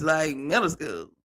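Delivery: ordinary talk, nothing marked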